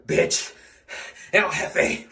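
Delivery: normal talking voice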